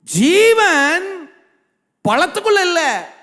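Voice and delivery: high, hugely drawn-out words; preacher's slow, ringing cry with long held vowels; emphatic proclamation. Acted. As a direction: proclaim the verse word by word. Proclaims the verse just read out.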